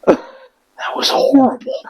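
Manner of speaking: slow, breathless whisper of shock; stunned. Retold or imitated. Air Force guy reacting to the sergeant's yell, stunned whisper. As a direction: let it out in a slow, shaken whisper. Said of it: "he's like".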